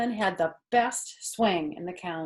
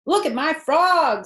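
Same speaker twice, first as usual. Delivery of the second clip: put-on high, louder eager boy; Avery showing off; 10 semitones higher, 9 dB louder than usual. A narrator's reading. Boy showing his frog to aunt, higher and louder. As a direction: thrust the frog forward proudly. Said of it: "said Avery".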